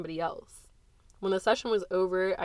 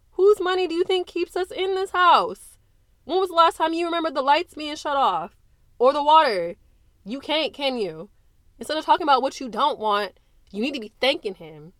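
loud, high, biting reproach; mother scolding her defiant daughter; scornful anger; 7 semitones higher, 6 dB louder than usual. Acted. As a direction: cut her daughter down to size. Mother's scornful reply in quarrel.

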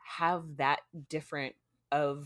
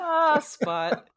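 louder, higher joyful cry of recognition; delighted cry; 8 semitones higher, 5 dB louder than usual. Real, not acted. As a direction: let out a happy cry at the name. Co-host reacts to the cat's return.

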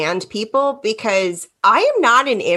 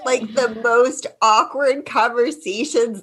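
ordinary talk

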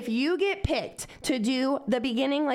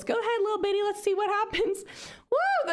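high-pitched taunting egging-on; mocking encouragement; 10 semitones higher than usual. Storyteller daring her competitive friend to take edibles, in mocking high-pitched encouragement. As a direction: goad her in a high, babying voice.

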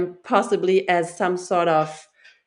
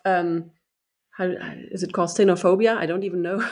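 ordinary delivery

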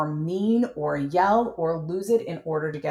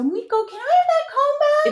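high, sing-song ask; sing-song question; 5 dB louder than usual. Shown as a demonstration. Mom asking her son for the comb, sing-song. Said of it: "It's, it's a question".

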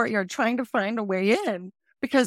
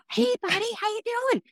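very high, sing-song voice for a dog; dog talk; 14 semitones higher, faster than usual. Retold or imitated. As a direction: coo up high at the friendly dog. Greeting the neighbour's dog.

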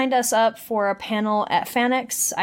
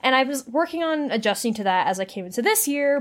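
usual speaking voice

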